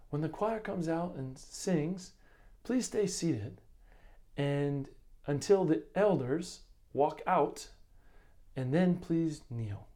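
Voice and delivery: gently instructing visitors in Caribbean lilt; sweet West Indian accent. Retold or imitated. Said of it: "in the most sweet West Indian accent".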